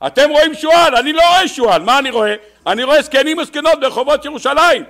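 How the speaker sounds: loud, high-pitched impassioned retort; emphatic exclaiming. Acted. Rabbi Akiva correcting the weeping sages, loud exclaiming. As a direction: cry it out to correct them.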